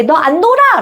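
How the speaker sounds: 높고 크게 쏘아붙이는 아이 흉내; child's retort. Retold or imitated. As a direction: snap it like a sulky kid. 친구가 놀지 않겠다고 함. (토라진 아이처럼 높게 쏘아붙이기)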